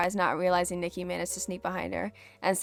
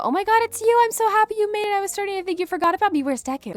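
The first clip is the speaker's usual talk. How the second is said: much higher, louder, breathless gushing; giddy Toga; 14 semitones higher, 5 dB louder, faster than usual. Acted. Toga gushing at Uraraka, much higher and giddy. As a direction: squeal it like an obsessed fangirl.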